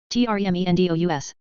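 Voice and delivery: letters rattled off fast; quick spelling. Shown as a demonstration. Teacher spelling the word fast for the class. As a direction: run through the letters briskly. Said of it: "spelling drill".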